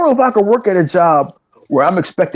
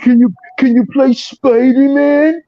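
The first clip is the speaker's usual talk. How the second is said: smug fanboy taunt; 6 semitones higher, 4 dB louder than usual. Retold or imitated. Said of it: "when I was trying to enjoy Sea of Thieves".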